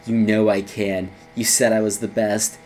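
menacing Danton. Retold or imitated. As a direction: drop deep and quiet, like a deadly tough guy. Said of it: "And then he goes".

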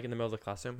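ordinary talk, nothing marked

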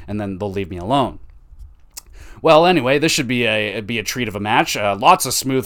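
normal talking voice

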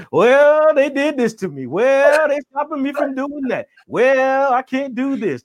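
high, nasal-free whiny victim voice; whiny complainer. Retold or imitated. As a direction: play the guy who always blames others.

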